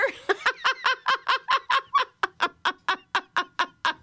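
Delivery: big laugh. Shown as a demonstration. Teacher leading laughter yoga, big hearty laugh. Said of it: "And a little louder".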